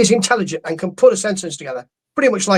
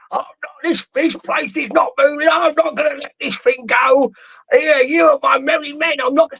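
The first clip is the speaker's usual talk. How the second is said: higher-pitched, hammed-up promoter voice, shouting at the peak; Eddie Hearn impression; 5 semitones higher than usual. Mocking the promoter blocking the ambulance, hammed-up high shout. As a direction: play a stubborn boss refusing to budge, half-yelling. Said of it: "it's a fairly good impression".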